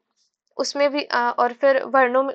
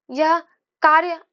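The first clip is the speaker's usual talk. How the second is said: "कार्य" set apart, higher and louder; stress on कार्य; 4 semitones higher, 4 dB louder than usual. Shown as a demonstration. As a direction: punch "कार्य" after a short pause. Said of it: "कार्य पे अगर जोर देंगे". ("कार्य" पर ऊँचे स्वर में जोर)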